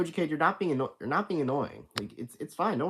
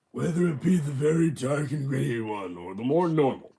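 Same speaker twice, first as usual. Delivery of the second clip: gritty Batman voice; 2 semitones higher, 5 dB louder than usual. Shown as a demonstration. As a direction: growl it like Batman. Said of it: "I think I've done Batman".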